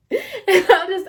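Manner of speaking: laughing through the words. Real, not acted. Retelling the awkward moment, laughing. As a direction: breaks into a laugh mid-sentence.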